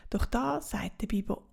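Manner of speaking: ordinary delivery, nothing marked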